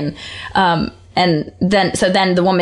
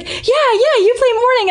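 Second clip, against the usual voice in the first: excited fan. Retold or imitated. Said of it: "and she was like".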